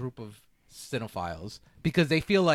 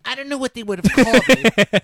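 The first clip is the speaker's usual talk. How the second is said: pretentious nerd voice; 12 semitones higher, 11 dB louder than usual. Retold or imitated. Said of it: "pretentious nerd voice".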